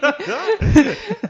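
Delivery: a loud, high burst of real laughter; hearty laugh. Real, not acted. Laughing at the joke about Portuguese being hard.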